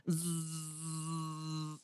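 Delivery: long, steady buzzing zzz; imitated drone buzz. Neighbor's drone creeping up, imitated steady buzz. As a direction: hold a droning buzz through the teeth. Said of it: "I hear this".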